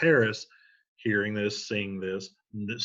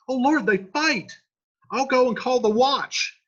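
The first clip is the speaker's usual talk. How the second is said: much higher and louder, alarmed; alarmed page; 12 semitones higher, 7 dB louder than usual. Acted. Paris's page seeing the fight, alarmed high cry. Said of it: "the page sees this".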